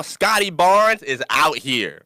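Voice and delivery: slow, weighty announcement of the name; dramatic reveal. Acted. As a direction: unveil the rival like a big reveal.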